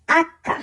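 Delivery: আক্কা with a long doubled k; আক্কা, Italian name for H; Italian acca. Shown as a demonstration. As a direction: stress আ, hold the double k in আক্কা. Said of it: "উচ্চারণ করা হয় আ এর মতো করে".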